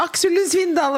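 high excited cry of his name; starstruck call-out. Retold or imitated. Imagines meeting Aksel Lund Svindal, calls out his name starstruck. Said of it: "roper jeg sikkert sånn".

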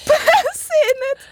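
very high, slow squeaky voice; a silly squeak far above her own voice; squeaky high echo. Pretend.

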